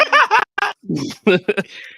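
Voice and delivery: high laugh. Real, not acted. Laughs after his impression.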